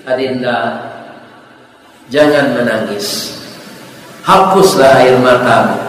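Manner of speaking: low, soft, soothing letter reading; hushed, caring reassurance; tender consolation. Acted. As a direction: gently comfort someone in tears. Doctor's consoling letter read out.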